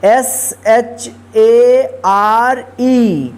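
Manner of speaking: letters spelled one by one, very slow; spelling out letters. Shown as a demonstration. Teacher spelling a word aloud slowly. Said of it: "share".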